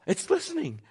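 high, delighted gasp of surprise; amazed the dog listens, high-pitched; surprised delight. Retold or imitated. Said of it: "she's like, gasps".

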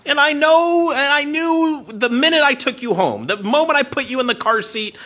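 high, loud, impassioned preaching; fervent pastor at full force. Acted. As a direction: preach it out to the whole room.

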